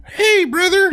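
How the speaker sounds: high-pitched wrestler catchphrase impression; Macho Man. Host does a muddled wrestler impression for laughing friends, high-pitched. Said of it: "do you wanna hear the Macho Man".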